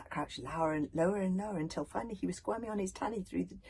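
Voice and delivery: plain reading voice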